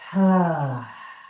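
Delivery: long open exhale sigh, loud; audible sigh. Shown as a demonstration. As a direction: let the breath out with a big sigh. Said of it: "With a sigh".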